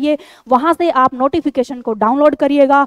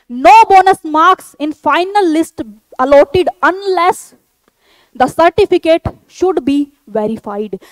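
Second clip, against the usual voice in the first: slow, emphatic read-out of a rule; reading aloud; 3 semitones higher, 3 dB louder, much slower than usual. Real, not acted. Teacher repeating the notification's rule to candidates, slow emphatic read-out. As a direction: spell out the rule slowly, phrase by phrase.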